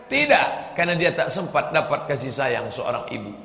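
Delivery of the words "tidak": sharp, high, loud no; emphatic no. Acted. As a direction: slam the answer down hard. Answers his own rhetorical question.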